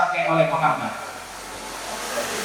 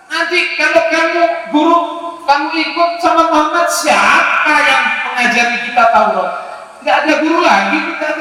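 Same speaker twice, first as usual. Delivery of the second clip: pleading disciples begging him to stay; disciples forbidding; 7 semitones higher than usual. Retold or imitated.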